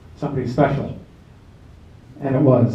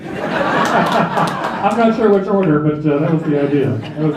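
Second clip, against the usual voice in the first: laughing through words; 3 semitones higher, 4 dB louder, slower than usual. Real, not acted. Emcee at the memorial laughing at the drinking line, real laugh. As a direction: laugh it out at the friend's cheeky wish.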